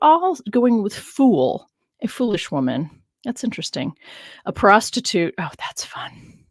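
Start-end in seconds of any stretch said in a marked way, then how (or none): 5.4-6.4 whisper. Real, not acted. Aside while reading a translation.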